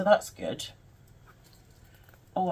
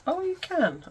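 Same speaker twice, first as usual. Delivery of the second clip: surprised; 13 semitones higher, 4 dB louder than usual. Real, not acted. Discovers pocket pulls out.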